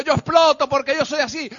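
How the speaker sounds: ordinary talk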